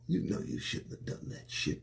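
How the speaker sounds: low, breathy telling-off under the breath; scolding whisper. Acted. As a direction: whisper the reproach like a secret.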